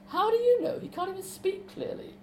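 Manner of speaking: put-on high, urgent girl's voice; [name]'s voice. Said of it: "[name] voiced high, arguing".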